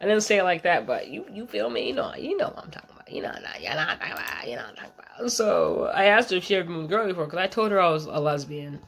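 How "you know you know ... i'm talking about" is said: unvoiced confiding whisper, a bit fast. Real, not acted. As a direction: lean in and whisper it to the viewers. Confiding aside to viewers.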